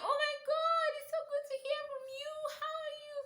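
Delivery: very high, gushing delight at the start; delighted squeal. Retold or imitated. Answering her boyfriend's surprise call, delighted squeal. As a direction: squeal with joy at hearing his voice.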